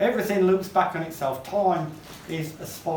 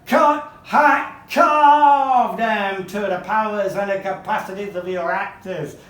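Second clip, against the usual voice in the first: Charles Dickens; 3 semitones higher, 7 dB louder, slower than usual. Acted. Dickens accusing stage adapters of hacking books, loud theatrical outrage. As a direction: hammer each verb like a furious orator. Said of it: "That's Charles Dickens speaking".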